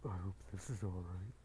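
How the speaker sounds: very low put-on character voice; low-voiced acted check-in; Huntress. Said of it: "Huntress turns to you".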